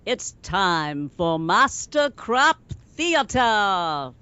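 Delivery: slow, drawn-out theatrical title call; mock-grand announcer. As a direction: announce it grandly, every word stretched.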